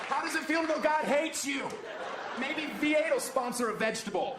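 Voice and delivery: high mock shout; hammed-up heckler yell; taunting shout. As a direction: yell it down at him from the stands.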